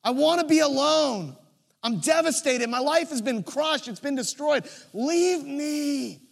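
Mary turning to Jesus, crying and begging to be left alone; imitating sobbing Mary. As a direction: cry out in devastation. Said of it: "crying, sobbing, just saying".

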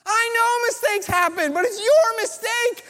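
hammed-up anger boiling over; mock angry outburst. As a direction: finally lose patience with the manager. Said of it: "I get a little angry".

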